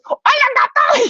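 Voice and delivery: high, squealed taunt; sister mouse greeting. Acted. Sister mouse greets the sleeping cat through the fence, squealing bravely. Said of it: "she felt a bit brave ... she goes".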